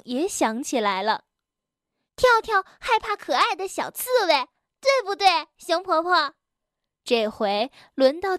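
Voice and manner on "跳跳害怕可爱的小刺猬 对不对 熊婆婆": put-on high, quick childlike voice; eager little animal child. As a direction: tell on your friend in a high kid voice. Narrator voices child character Bengbeng. (高而快的小孩腔，抢着告状)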